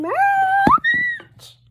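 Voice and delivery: high-pitched, drawn-out squeal; excited scream. Real, not acted. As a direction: scream the word out, high and loud. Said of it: "Sorry that I screamed out".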